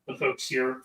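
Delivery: ordinary talk, nothing marked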